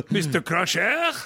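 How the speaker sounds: put-on laughing French-accented Picard; comic French captain voice. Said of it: "who would have taken me seriously".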